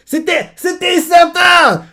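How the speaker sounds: shouted high and slow, thrilled; crying out that he called it; excited shout. Real, not acted. Viewer shouting at the twist, thrilled.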